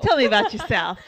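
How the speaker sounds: repeats it in a stiff, put-on interviewer voice; lightly mocking interview question; mock formal interviewer. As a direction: pretend they're strangers meeting. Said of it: "We've never met before".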